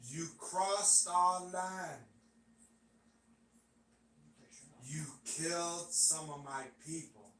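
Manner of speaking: soft, slow, spaced-out warning; speaking slow. Acted. Asked to slow down Alpha's warning, slow impression. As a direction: take each phrase very slowly and softly. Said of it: "They want me to speak slow".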